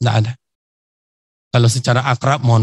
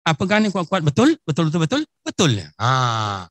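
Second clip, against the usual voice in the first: a Riau Malay uncle voice, higher, rising on betul; lightly done Malay accent, higher; 4 semitones higher than usual. Retold or imitated. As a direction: coax the old Malay pakcik, pitch climbing. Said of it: "Melayu ni".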